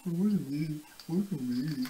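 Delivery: a hint of a sheepish dog, soft and slowed; guilty dog voice. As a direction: mumble it like a guilty pup. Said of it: "the dog is there going".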